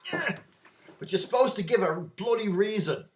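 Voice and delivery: high, loud, slow mock-English scolding; English constable. Constable chiding a local about the form, loud mock-English accent. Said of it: "my English accent is bloody bad".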